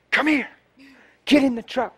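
high, urgent call across the street; retold urgent call. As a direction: call her over. Calling the girl to the truck.

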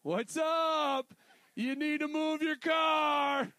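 calling out. Retold or imitated. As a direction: call it slowly into the camera. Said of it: "looking at somebody through their license plate".

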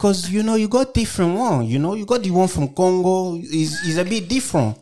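high Congolese French-African accent; French Congo accent. Shown as a demonstration. Showing his co-hosts how Congo sounds, French Congo accent. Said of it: "That's the French Congo accent".

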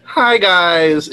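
customer service voice. Retold or imitated. Guest imitating Allie's outro, customer service voice. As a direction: greet like a fake-friendly service rep. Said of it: "customer service voice".

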